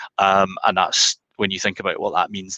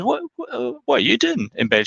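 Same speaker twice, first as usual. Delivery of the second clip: puzzled husband; 14 semitones higher than usual. Acted. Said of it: "her husband comes into the bedroom and says".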